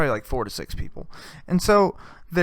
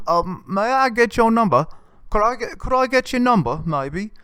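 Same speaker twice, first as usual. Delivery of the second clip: high put-on Australian accent, shy asking; Australian accent impression; 8 semitones higher than usual. Comic voicing the skit guy asking the cop, in a high Australian accent. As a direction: ask for her number in a goofy Australian accent. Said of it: "this is full Australian".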